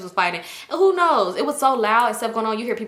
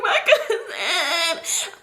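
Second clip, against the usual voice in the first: high, very slow comic wail; crying; 9 semitones higher, much slower than usual. Retold or imitated. Girl crying out for her missing cousin, acted slow wail. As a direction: stretch it into a hammed-up sob. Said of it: "I'm crying. I'm like".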